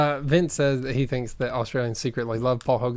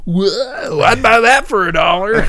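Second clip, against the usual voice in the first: Benny Hill impression; 6 semitones higher, 9 dB louder, slower than usual. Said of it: "and he'd go".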